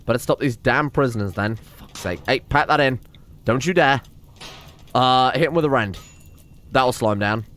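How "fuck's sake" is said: under-breath whispered swear at a stubborn colonist; whispered curse. Real, not acted. Exasperated at an escaping prisoner, whispered curse. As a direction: hiss it out, fed up.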